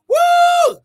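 very high, loud, drawn-out "woo"; ecstatic whoop. Real, not acted. Preacher celebrating God's answer, ecstatic whoop. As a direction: burst into a piercing praise whoop.

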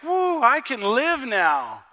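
loud, slow whoop of relief; Paul overjoyed, loud and drawn out; relieved joy. Retold or imitated. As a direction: let the relief pour out slowly. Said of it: "And Paul's like".

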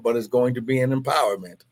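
ordinary talk, nothing marked

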